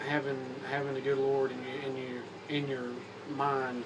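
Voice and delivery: ordinary talk, nothing marked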